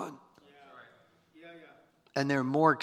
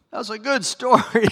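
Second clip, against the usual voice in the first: delighted; 10 semitones higher, 6 dB louder than usual. Real, not acted. Delighted reaction after reading scripture.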